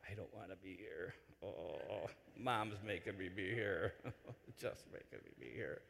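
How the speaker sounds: put-on boy grumbling that Mom made him come; grumbling sixth grader. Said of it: "typical sixth grade".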